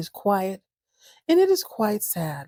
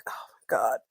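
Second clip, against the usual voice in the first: hushed whisper; 9 semitones lower, 6 dB quieter than usual. Retold or imitated. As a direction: whisper it to yourself. Adam's voiced inner thought.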